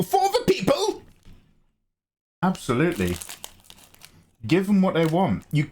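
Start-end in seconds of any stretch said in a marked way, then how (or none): 0.0-1.0 mock heroic cry, far higher and slow; rallying cry. Streamer hams up his game drug-cooking for chat, high proclamation. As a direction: proclaim it like a hammy hero.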